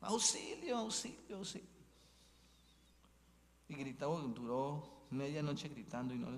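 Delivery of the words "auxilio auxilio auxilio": cries for help. Acted. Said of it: "empieza a gritar".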